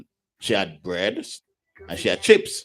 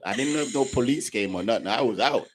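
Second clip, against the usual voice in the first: usual speaking voice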